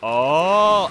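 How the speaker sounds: loud sustained exclamation of understanding; dawning realisation. Real, not acted. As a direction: let a long oh swell as it dawns. Sudden realisation in game.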